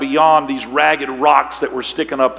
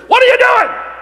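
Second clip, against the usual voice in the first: much higher, loud incredulous question; instructor's challenge; 6 dB louder than usual. Retold or imitated. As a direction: play the startled instructor calling out. Instructor's sharp quoted question.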